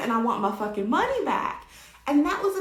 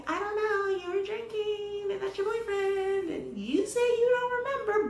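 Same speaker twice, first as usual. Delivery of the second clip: mock doubtful cops; 7 semitones higher than usual. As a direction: hem and haw in a high singsong. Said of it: "cops were a little bit shady".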